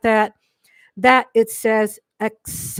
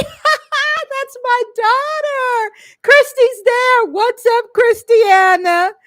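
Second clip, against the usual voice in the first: higher, louder, slow and beaming; delighted; 11 semitones higher, 6 dB louder, slower than usual. Real, not acted. Mother introducing her daughter to viewers, excited. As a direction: gush over her on screen.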